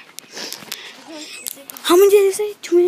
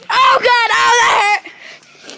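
mock pained cry; much slower than usual. Pretend pain after wrestling move.

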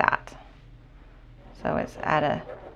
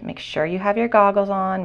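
usual speaking voice

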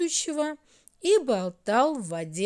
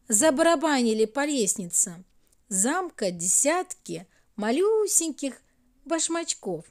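ordinary delivery

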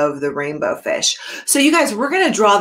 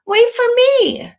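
much higher, louder slow call; little fish calling; 5 dB louder than usual. Acted. Little blue fish calling after Rainbow Fish, high and loud. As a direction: call after him, pleading. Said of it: "he called".

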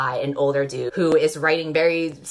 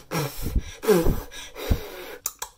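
mock sigh. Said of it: "He's like".

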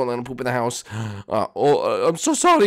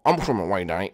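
Jeffy voice; 6 semitones lower, faster than usual. Acted. Jeffy answers his parent, low sulky kid voice. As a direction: mumble it like a stubborn kid.

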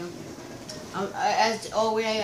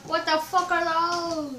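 surprised; 8 semitones higher, slower than usual. Real, not acted. Reacting to friend's shoes.

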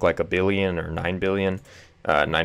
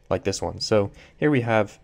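ordinary talk

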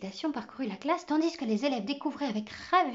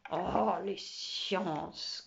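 très lent grognement d'élève; traîne sur chaque mot en grognant; grognon; 3 semitones lower, much slower than usual. Acted. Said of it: "grogna Martin". (grognement traînant et dépité)

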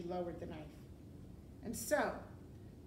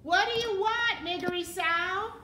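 high, loud shouted question; shouting; 10 semitones higher, 11 dB louder than usual. A narrator's reading. Princess repeating her question to Mig, shouting. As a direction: call it out for the hard of hearing. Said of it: "the princess shouted".

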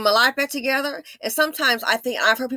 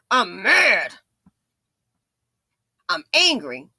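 lightly voiced angry man, a bit louder; mock angry man; 4 dB louder than usual. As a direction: imitate a hothead spitting it out. Mimics the angry Dusties.

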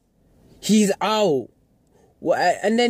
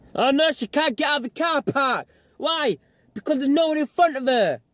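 mocking exasperation; 8 semitones higher than usual. Real, not acted. Mocking the driver's plight.

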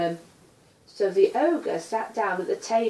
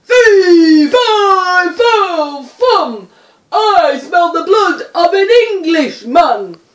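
loud, high booming giant's chant, slowing at the end; ogre roaring; 8 semitones higher, 16 dB louder than usual. A narrator's reading. Ogre roaring after Jack as he flees, loud and high. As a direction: bellow it like a hungry giant on the hunt. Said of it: "Behind him he could hear".

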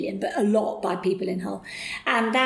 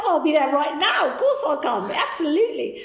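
higher, louder eager acceptance; eager yes; 7 semitones higher, 4 dB louder than usual. Retold or imitated. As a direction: jump at the offer, thrilled.

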